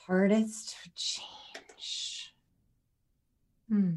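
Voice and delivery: slow, near-whispered pondering; soft, drawn-out musing to oneself. Real, not acted. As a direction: think it over under your breath, drawn out. Pondering a hard question aloud.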